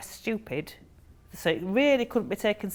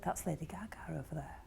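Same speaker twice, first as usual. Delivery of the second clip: hushed conspiratorial whisper; excited whisper pointing something out; 2 semitones lower, 14 dB quieter than usual. Retold or imitated. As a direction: lean in and whisper like sharing a secret. Pointing out a secret sighting.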